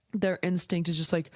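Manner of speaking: usual speaking voice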